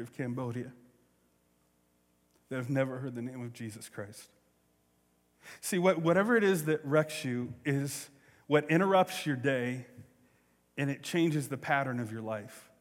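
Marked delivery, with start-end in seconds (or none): none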